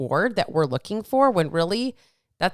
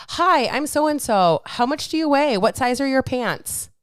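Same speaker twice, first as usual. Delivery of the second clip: high, slow, sing-song fake introduction; mock cheery greeting; 6 semitones higher, slower than usual. Mocking how no one greets a stranger, high and slow. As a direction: play the absurd stranger asking your weight, high and drawn out.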